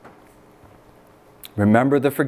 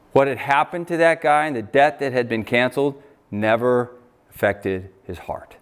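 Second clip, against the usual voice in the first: ordinary talk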